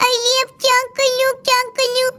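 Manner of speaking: normal talking voice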